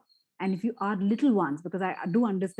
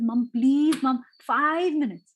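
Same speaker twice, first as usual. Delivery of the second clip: put-on higher, slow whiny plea from a kid; child begging mom for more playtime, higher and drawn out; pleading child; 5 semitones higher, 4 dB louder, slower than usual. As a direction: beg mom for five more minutes, a little whiny.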